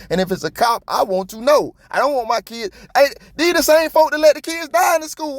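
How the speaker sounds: high-pitched, outraged rant; real indignant outburst, pitch soaring; heated rant. Real, not acted. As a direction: vent it at full heat, voice way up. Outraged rant about cops in schools.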